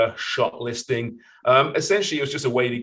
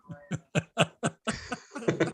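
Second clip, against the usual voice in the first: high amused laugh; high chuckle; 10 semitones higher, 6 dB quieter than usual. Real, not acted. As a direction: let out a high giggle. Laughing at drug joke.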